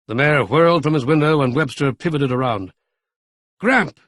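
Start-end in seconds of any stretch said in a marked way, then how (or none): 3.6-4.0 high yelled call across the room; surprised yell of a name. A narrator's reading. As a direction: shout his grandfather's name. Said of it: "he yelled".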